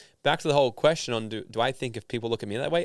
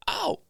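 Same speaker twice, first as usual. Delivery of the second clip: high-pitched imitated "ow"; mock pain yelp. Man on the other end reacting to a shock, mock pain yelp. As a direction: act out a sudden zap with a high yelp.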